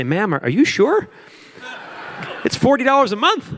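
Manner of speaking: asking a customer in disbelief if she is sure; disbelieving question. Retold or imitated. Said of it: "I had to say".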